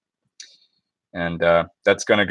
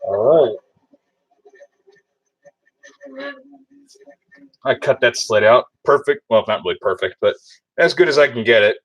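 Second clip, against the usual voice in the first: muffled behind a respirator mask; through a respirator; 4 semitones higher, 4 dB louder than usual. Real, not acted. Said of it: "Off with this stupid respirator".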